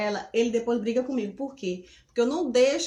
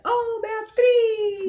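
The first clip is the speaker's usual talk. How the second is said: drawn-out high call across the room; 9 semitones higher, 3 dB louder, much slower than usual. Real, not acted. Mother calling her daughter to join the live, drawn-out call. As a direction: call her name slowly to fetch her.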